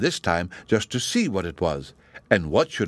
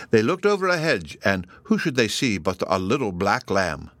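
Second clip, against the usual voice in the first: ordinary delivery, nothing marked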